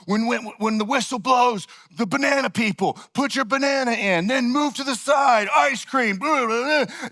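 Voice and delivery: line them up like a drill sergeant; drill sergeant mode. Retold or imitated. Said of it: "drill sergeant mode".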